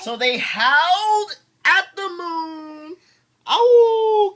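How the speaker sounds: dad leads the howling scene; excited read into a howl. Acted. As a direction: whip the kids up to howl.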